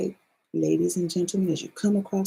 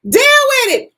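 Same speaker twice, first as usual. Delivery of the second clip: high, loud and emphatic; a sudden loud high declaration; loud exclamation; 12 dB louder than usual. Real, not acted. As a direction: belt it out like a verdict. Exclaiming after a laugh.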